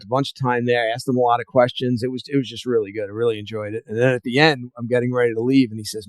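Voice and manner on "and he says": ordinary delivery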